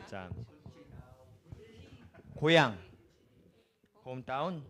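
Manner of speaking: normal talking voice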